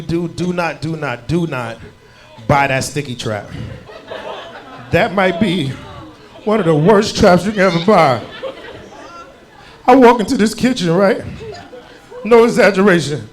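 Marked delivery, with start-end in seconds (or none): none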